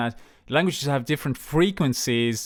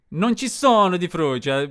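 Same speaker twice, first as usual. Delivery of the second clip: higher-pitched, hammed-up Italian delivery; 4 semitones higher, 3 dB louder than usual. Shown as a demonstration. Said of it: "go into the whole stereotype of".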